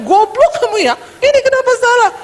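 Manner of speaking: high-pitched mock tirade; old angry outburst. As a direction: tear into an employee, pitch shooting up. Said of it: "Kalau dulu kan dia ngomong".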